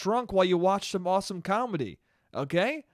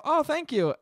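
overly polite thanks; 10 semitones higher than usual. Retold or imitated. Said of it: "I'll go".

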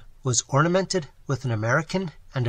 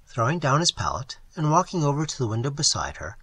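ordinary delivery

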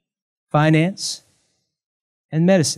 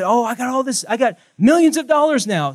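higher and louder, thrilled lottery winner; retold excited; 8 semitones higher, 6 dB louder than usual. Preacher voicing a man who thinks he won the lottery, excited. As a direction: burst out like you just won millions. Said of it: "he got so excited that he said".

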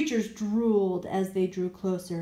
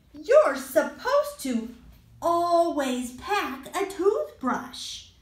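put-on scolding boy; 6 semitones higher, 5 dB louder than usual. Ian scolding the monsters.

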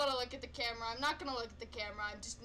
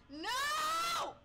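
high sudden yell; scared shout; 13 semitones higher, 3 dB louder than usual. Real, not acted. Frightened gamer shouting at the screen, sudden high yell. Scream at game.

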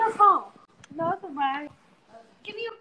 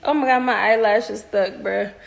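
ordinary talk, nothing marked

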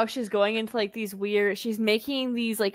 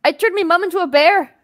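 put-on Scottish accent; 7 semitones higher, 8 dB louder than usual. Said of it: "she's like / Scottish accent".

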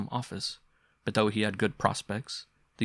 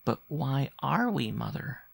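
put-on slow, higher child's voice; young boy; 5 semitones higher, slower than usual. A narrator's reading. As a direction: press the question as a small boy. Boy questioning his mother.

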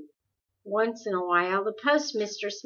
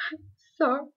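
higher, a mild sob in the voice; sobbing; 7 semitones higher than usual. Acted. Meg apologises to Calvin, sobbing mildly. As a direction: say it through a light sob. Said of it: "she sobbed finally".

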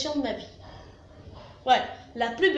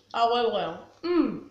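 chewing while talking; crunchy snack in mouth; mouth full of chips; 3 semitones lower, slower than usual. Real, not acted. Seen 1: Bites a chip at 1.2s, then chews while talking.

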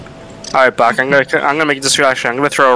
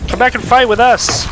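shouting; 10 semitones higher than usual. Real, not acted. Calling out in fight.

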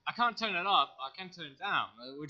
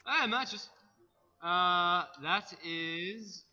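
ordinary talk, nothing marked

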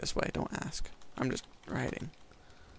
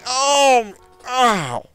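pained yelp; 11 semitones higher, 13 dB louder than usual. Real, not acted. Crashing bike in game.

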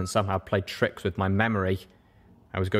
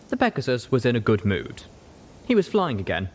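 ordinary delivery

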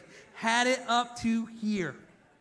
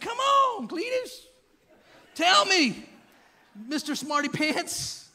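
much higher, louder teasing jeer; mocking God; 9 semitones higher, 8 dB louder, slower than usual. God taunting Job as Mister Smarty Pants. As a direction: tease him like a schoolyard taunt. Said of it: "He gets really sarcastic".

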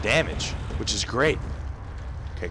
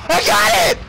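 very high, loud shriek; screeching victory cry; triumphant scream; 7 dB louder than usual. Real, not acted. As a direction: scream it in sudden excitement. Lands move in fight.

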